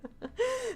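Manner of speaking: soft high giggle; short, high, quiet laugh. Real, not acted. Laughs after mock refusal.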